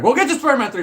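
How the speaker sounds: high and loud excited promise. Real, not acted. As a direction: blurt it out eagerly. Repeats promise, first louder.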